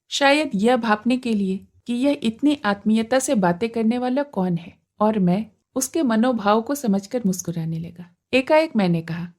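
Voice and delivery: ordinary delivery, nothing marked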